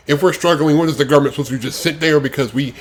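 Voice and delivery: usual speaking voice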